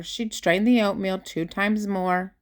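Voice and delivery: ordinary delivery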